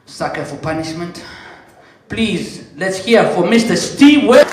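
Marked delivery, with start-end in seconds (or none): 2.1-4.5 loud, raised stage call to bring him on; announcer call. Acted. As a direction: boom the name out to the crowd. Calling the comedian to stage.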